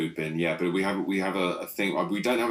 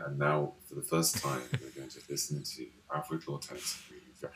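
strokey intellectual; 4 semitones lower, 8 dB quieter than usual. Retold or imitated. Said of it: "beardy intellectuals saying".

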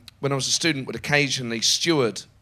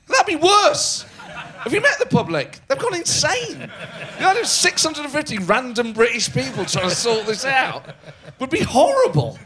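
incredulous outburst; 10 semitones higher than usual. Real, not acted. Mocking the idea of random citizens, high incredulous squawk. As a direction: shriek it like it's absurd.

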